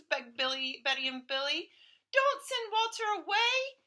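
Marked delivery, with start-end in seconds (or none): 2.1-3.8 put-on child pleading.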